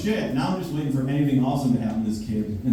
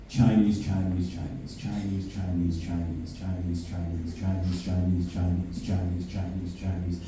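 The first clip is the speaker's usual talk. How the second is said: low, quiet mock chatter of "Chinese"; lightly voiced overheard chatter, lower and softer; 6 semitones lower, 7 dB quieter, slower than usual. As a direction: repeat "Chinese" low and soft like overheard women. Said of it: "not gonna do a Chinese impression".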